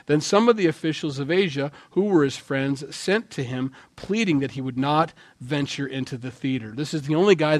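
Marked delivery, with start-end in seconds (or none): none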